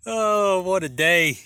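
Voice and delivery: normal talking voice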